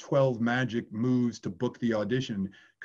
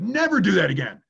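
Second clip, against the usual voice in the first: louder, raised-pitch mock scolding; stern warning; 6 semitones higher, 6 dB louder than usual. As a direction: act out an agent laying down the law. Retold agent warns the young man.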